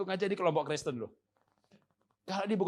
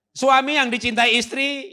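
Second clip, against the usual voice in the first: emphatic preaching; 10 semitones higher, 13 dB louder, slower than usual. Acted. Preacher stressing key phrase.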